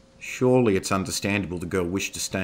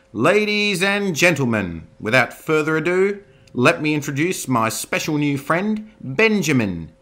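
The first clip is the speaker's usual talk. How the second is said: showman announcement; 9 semitones higher, 9 dB louder than usual. Acted. Mindy introducing the wolf to the hall like a ringmaster. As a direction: present it like a circus showman.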